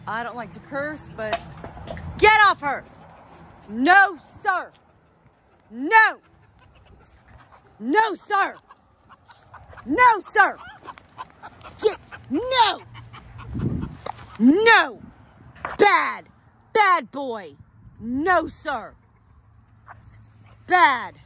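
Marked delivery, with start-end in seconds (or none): 2.2-15.0 high, forceful commands to a dog; alarmed scolding shout. Real, not acted. Owner ordering dog off bird, urgent shout. 15.8-21.1 higher, sharply falling reprimands; stern scolding. Real, not acted. Owner to dog that grabbed bird, stern scolding.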